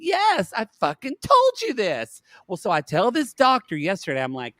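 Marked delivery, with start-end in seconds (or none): 0.0-2.2 high-pitched vindicated outburst, retold; exasperated retort. Caregiver retelling her answer to surprised staff, exasperated. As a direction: act out a fed-up I-told-you-so.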